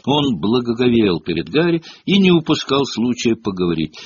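ordinary delivery, nothing marked

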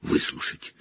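fading to a rasping whisper; hoarse prisoner pleading to be heard. Acted. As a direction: force the words out, barely voiced. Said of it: "хрипло проговорил он".

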